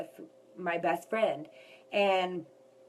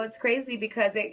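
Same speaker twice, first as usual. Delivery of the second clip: usual speaking voice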